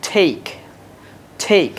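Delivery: টেক with a breathy English T; টেক, puff of air on the T; টেক like English take; aspirated take. Shown as a demonstration. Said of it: "but কি বলতে হবে?".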